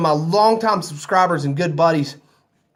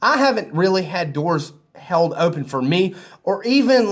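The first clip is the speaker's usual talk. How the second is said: ordinary talk, nothing marked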